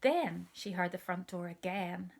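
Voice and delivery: ordinary delivery, nothing marked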